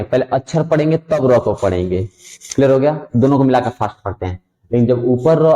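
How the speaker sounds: usual speaking voice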